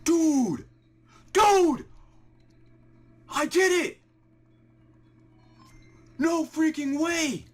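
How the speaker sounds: high, loud shrieks of disbelief; screaming in thrilled disbelief; ecstatic screams. Real, not acted. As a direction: scream with pure joy at the find. Finds shiny after long hunt.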